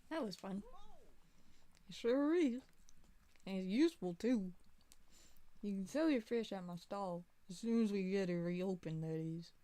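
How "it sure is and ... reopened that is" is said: put-on southern accent. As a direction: play a folksy Southern man. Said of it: "I'm just doing, like, a southern accent".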